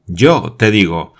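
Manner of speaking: exclaiming firmly. A narrator's reading. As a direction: insist you will go yourself. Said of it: "exclamó Glenarvan".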